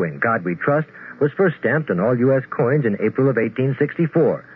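ordinary talk

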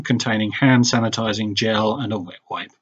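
ordinary delivery

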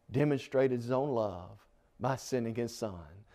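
ordinary talk, nothing marked

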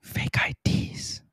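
slow, breathy whisper again. Pretend. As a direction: hush it like a secret. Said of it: "I don't know if they can hear you whisper that whispery".

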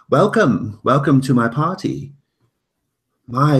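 loud, high singsong host greeting; booming sing song welcome. Acted. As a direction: boom out a grand welcome to guests. Host greets guests at party.

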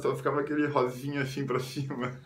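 ordinary talk, nothing marked

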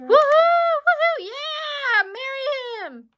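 high, loud whooping cheer. Pretend. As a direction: whoop it up for the couple. Cheering a marriage proposal.

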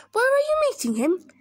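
high, loud, fast question; alarmed snake. Acted. Snake asks mouse about the monster, alarmed.

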